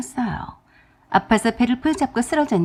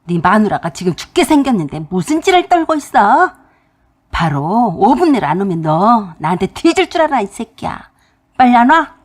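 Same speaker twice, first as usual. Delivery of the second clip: roaring father; 7 dB louder than usual. Retold or imitated. Father roaring at his son to come now, furious and loud. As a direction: thunder at the son. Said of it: "큰 소리로".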